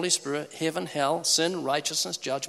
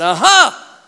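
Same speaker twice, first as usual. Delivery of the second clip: triumphant aha; 8 semitones higher, 12 dB louder than usual. Retold or imitated. Stranger catches him in pool.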